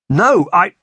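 high, loud, cut-off denial; alarmed protest. A narrator's reading. Captive protesting before the whip lands, alarmed blurt.

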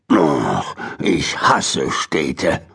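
put-on low, slow grumbling old man; low slow grumble, muttered to himself; growled grumble. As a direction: grumble it low under your breath. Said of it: "knurrte Mister Morrison vor sich hin".

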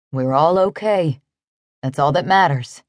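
low, gentle reassurance; kindly. A narrator's reading. Cowboy comforting frightened woman, kindly. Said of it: "he said kindly".

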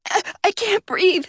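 panicked. Acted. Suit leaking, air running out.

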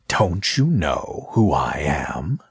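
slow, low menacing whisper. Acted. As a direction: hiss it out slowly. Snake looms over Eve.

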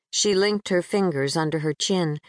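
ordinary delivery

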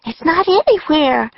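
high-pitched alarm; panicked cry. A narrator's reading. Annie unable to find the book home, panicked cry. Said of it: "cried Annie".

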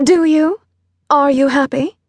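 pointed high-voiced questioning; intent Veronica. A narrator's reading.

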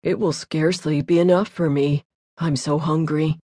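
a big, unhurried eater shrugging it off; glutton voice. A narrator's reading.

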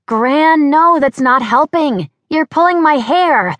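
put-on high, loud, frantic young woman's cry; young American woman. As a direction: yelp it as the young girl tangled in the tent. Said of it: "a very young-sounding American woman's voice".

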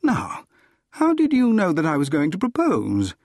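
wondering, pitch lifted at the start; genuine wonder. Acted. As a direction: ask it in open amazement. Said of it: "I asked in genuine wonder".

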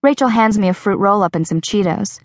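usual speaking voice

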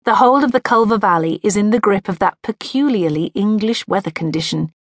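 plain reading voice

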